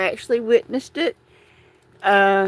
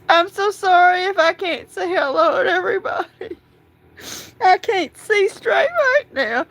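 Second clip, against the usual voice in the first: crying; 7 semitones higher than usual. Real, not acted. Cat just killed by dog.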